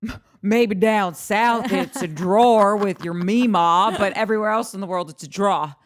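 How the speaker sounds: sarcastic comeback to a Southern friend; mock retort. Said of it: "I'm like".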